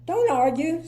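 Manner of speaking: firm cheerful. Acted. Mister Happy firm.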